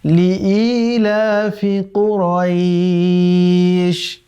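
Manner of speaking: slow, drawn-out melodic tilawat; Quran recitation. Shown as a demonstration. Teacher recites the verse for students in slow melodic chant. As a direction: chant the verse slowly with long held vowels. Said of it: "তখন আমরা পড়ব".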